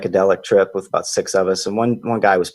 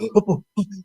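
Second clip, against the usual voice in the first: retold gasp; 10 semitones higher than usual. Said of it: "he's like".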